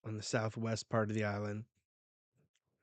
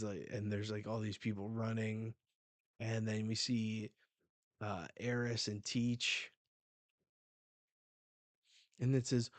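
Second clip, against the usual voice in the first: ordinary talk, nothing marked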